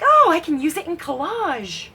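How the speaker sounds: high-pitched voice of her own excuse; mock self-justifying. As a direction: chirp it like a cheerful excuse. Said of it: "I'm like".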